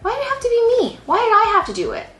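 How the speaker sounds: high-pitched exasperated complaint; indignant protest. Retold or imitated. Daughter complaining why it had to be her, indignant. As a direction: protest the unfairness, pitch shooting up.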